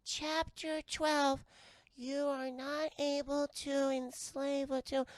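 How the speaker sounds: high, slow creature voice; little monsters reading. Acted. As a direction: read the rule out laboriously in a tiny voice. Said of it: "And they go".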